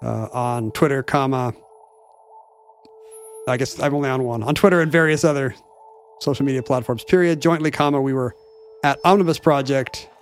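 spoken punctuation, every mark read aloud; dictating. Pretend. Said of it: "reading punctuation aloud".

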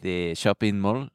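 attempted Israeli accent; lightly put-on Israeli accent. Shown as a demonstration. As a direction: try the phrase in an Israeli accent. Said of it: "the Israeli accent is a lot like this".

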